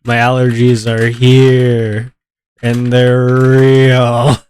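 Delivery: dramatic slow announcement of allergies; slow stuffy declaration. Real, not acted. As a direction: stretch each word out like a proclamation. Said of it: "You sound so stuffy".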